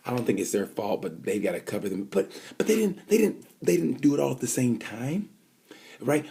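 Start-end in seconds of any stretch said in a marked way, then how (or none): 2.0-6.3 high, insistent protest; worked up. Real, not acted. Vlogger protesting the jump starts, high and worked up. Indignant rant about start.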